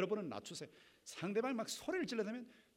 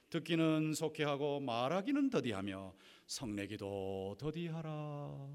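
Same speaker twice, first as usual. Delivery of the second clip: scripture recitation; 4 semitones lower, slower than usual. Acted.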